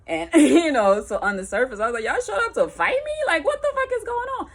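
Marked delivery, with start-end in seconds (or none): 2.0-4.5 high, loud, fast disbelief; shocked outburst. Retold or imitated. She reacts to them showing up, loud shock. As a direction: blurt out that they came to fight. Confronted by both.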